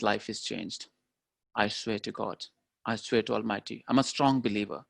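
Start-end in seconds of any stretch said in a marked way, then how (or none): none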